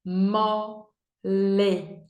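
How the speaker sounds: Mole split into "mo... le"; syllable by syllable. Shown as a demonstration. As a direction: say Mole slowly in two separate beats. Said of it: "Duas sílabas". (Mole em sílabas: "mo... le")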